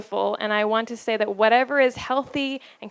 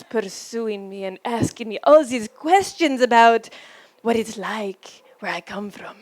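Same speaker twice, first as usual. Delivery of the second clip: put-on terrible French accent. Wife mimicking her husband's role as Jacques, in bad French accent. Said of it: "I also do bad accents, apparently".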